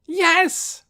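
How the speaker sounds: very high, loud squeal; thrilled little brother. A narrator's reading. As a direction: squeal it to an adored big brother. Thrilled boy answers returning brother.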